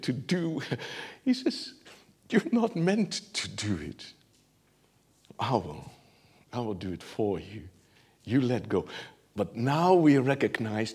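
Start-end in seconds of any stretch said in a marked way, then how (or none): 2.3-8.8 gentle assurance. Acted. God voiced gently.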